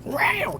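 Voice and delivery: high imitated cat yowl. Showing the cat's noise to his friend, a high yowl. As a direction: squeal it like a fighting cat. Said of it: "It was like that".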